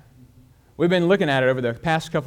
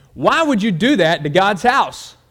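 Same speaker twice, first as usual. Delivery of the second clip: louder, higher, slow and pressing; pastor asking again, louder; 5 semitones higher, 6 dB louder than usual. Acted. Pastor to silent boy, louder and insistent. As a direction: press the question harder, slowly. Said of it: "Pastor asked him again".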